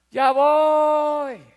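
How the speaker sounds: put-on child yelling from his room; kid shouting back. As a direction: holler back without moving. Son answers mother lazily.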